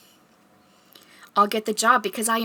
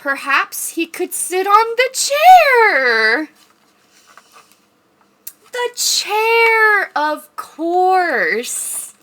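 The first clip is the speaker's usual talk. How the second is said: high, loud, slow squeaky voice; mouse voice; 10 semitones higher, 10 dB louder, much slower than usual. Pretend. Mouse character suggesting the chair, high squeaky. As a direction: talk like a tiny excited mouse.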